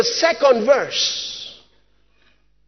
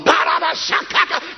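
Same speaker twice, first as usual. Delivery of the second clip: imitated speaking in tongues; 4 semitones higher than usual. Kidnapped woman bursting into tongues, imitated outburst. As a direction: burst of high-pitched tongues. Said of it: "She just blew up".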